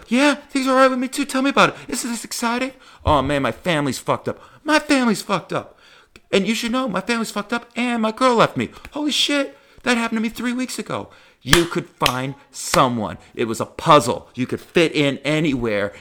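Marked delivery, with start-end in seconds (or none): 0.1-2.7 much higher, louder, overeager squeaky voice; mock eager comic. Plays second eager comic. 4.6-11.1 much higher, louder, one-upping squeak; mock eager comic. Plays second eager comic.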